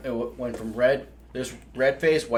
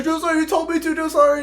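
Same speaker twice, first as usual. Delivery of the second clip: high pleading excuse, a touch mock; whiny recruit; 5 dB louder, faster than usual. Recruit making excuses to the drill sergeant, whiny. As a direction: plead innocently, voice pitched up. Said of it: "He's like".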